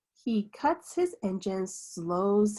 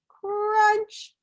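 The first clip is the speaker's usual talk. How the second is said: crunch sound; 11 semitones higher than usual. A narrator's reading. Sound of the dumped load.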